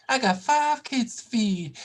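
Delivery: slow, high comic catchphrase; mock movie quote. Mock quoting a movie character.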